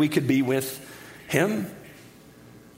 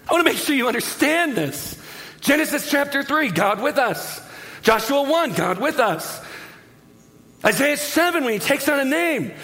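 fervent preaching; 6 semitones higher than usual. Acted. Preaching at full force.

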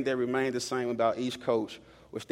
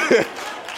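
short unvoiced laugh burst; preacher laughing at his own joke; breathy laugh; 11 semitones higher, 8 dB louder than usual. Real, not acted.

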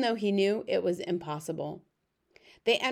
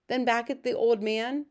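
usual speaking voice

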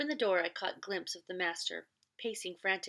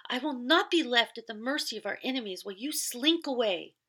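higher and louder furious protest, stress on be; a wife's raging reproach, still loud; angry outburst; 5 semitones higher, 7 dB louder than usual. Acted. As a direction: scorn him for running away. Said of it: "she shouted".

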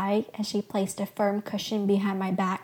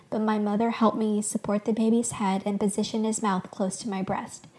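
ordinary delivery

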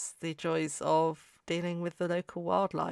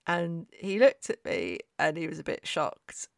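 ordinary delivery

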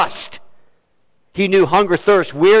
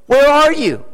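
higher-pitched cry, building to a peak; 7 semitones higher, 3 dB louder than usual. Acted. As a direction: cry out desperately, rising on the repeat. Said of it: "what does he cry out?".

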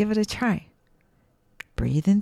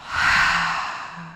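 demo sigh. Shown as a demonstration. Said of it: "a big sigh out through your mouth".

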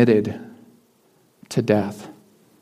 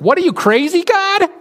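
much higher, shocked squeak; incredulous Moses. Retold or imitated. Moses balking at God's offer, high shocked voice. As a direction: recoil at the offer in disbelief. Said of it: "And he's like".

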